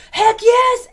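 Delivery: high, loud enthusiastic yell; eager cheer. Retold or imitated. Strangers gladly agreeing to carry the mattress, cheering. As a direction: shout it like an eager helper.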